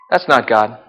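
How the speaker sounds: quiet, certain dismissal; hushed verdict. Acted. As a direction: drop to a hush.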